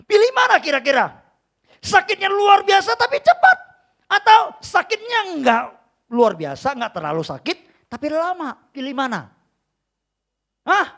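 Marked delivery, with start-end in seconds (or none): 0.1-6.7 loud, high challenge to the congregation; raised-voice sermon questioning; forceful preaching. Acted. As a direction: shout the choices out from the pulpit.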